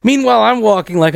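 ordinary delivery

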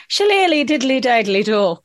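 sing-song stage-Irish gibberish; mock Irish accent. Irish guest imitating people who mock them, stage-Irish lilt. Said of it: "if they mock you a bit".

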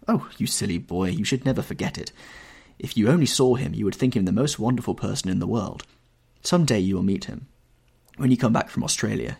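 ordinary delivery, nothing marked

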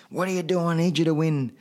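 retold cornerman's plea, pitched high; retold urging. Trainer scolding him in the ring.

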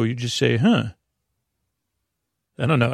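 ordinary delivery

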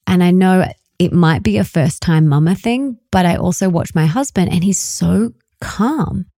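ordinary talk

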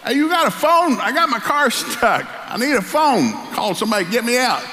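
stranded driver shouting for a phone; calling across a field. Retold or imitated. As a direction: hollers out for help from the road. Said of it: "And he yells at him".

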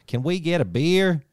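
put-on American accent, stretching "beer" with a hard R. Tablemate translating the beer order, mock American accent. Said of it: "someone else at the table would be like".

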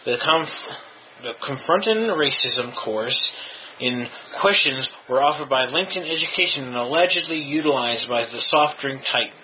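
ordinary delivery

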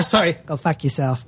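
mock Boston accent; jokey apology in a Boston accent. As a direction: redo it in thick Boston vowels. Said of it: "than the Boston accent".

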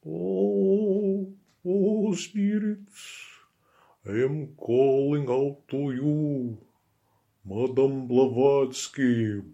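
high, drawn-out Eastern European wail; séance medium's wail. Acted. Medium calling out to spirits at a séance, high wailing accent. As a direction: summon the spirits in a long, high, eerie call. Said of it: "mobile Eastern European improvised accent".

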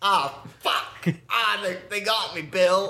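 high, hammed-up cry of being shot; mock wounded Aussie. Wounded soldier to Bill in emu war skit, mock agony. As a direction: play-act a hit soldier, Australian accent.

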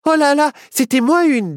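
high-pitched gasp of relief; high story-witch voice; relieved little witch. Acted. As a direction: exclaim it high, after a narrow escape. Witch relieved after near miss.

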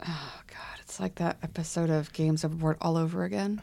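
hushed mock dread; whispering.

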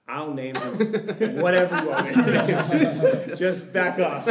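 laughing through the quote. Real, not acted. Teller retells dad telling the stranger off, laughing hard. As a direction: quote breaks into high loud laughter.

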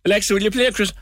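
high, bright Cork lilt asking Alexa; sing-song Cork voice talking to Alexa; mock Cork accent on a smart speaker command; Cork accent request. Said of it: "Cork Alexa".

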